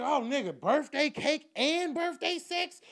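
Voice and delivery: high, slow, thrilled; giddy excitement. Retold or imitated. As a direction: stretch it out gleefully. Giddy over invitation.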